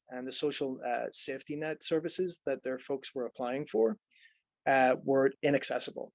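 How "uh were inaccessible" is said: ordinary talk, nothing marked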